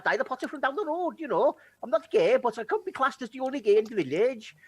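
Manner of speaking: put-on high, lilting Welsh accent. GM shows players how Welsh really sounds, in a Welsh accent. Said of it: "Welsh, it sounds more like this".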